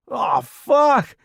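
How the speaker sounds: sudden high yell of terror; startled loud curse; panicked shout. Acted. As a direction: cry out as the bomb hits. Bomb explodes beside him.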